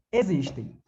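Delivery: normal talking voice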